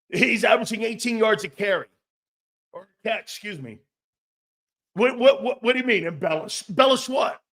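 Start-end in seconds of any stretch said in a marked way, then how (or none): none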